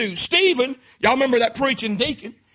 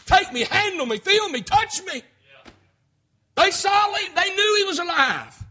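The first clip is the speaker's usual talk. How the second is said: high, urgent pleading climax; fervent preaching; 7 semitones higher than usual. Acted. Preacher voicing the risen Jesus to his congregation, fervent and high. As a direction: build upward in pitch with rising conviction.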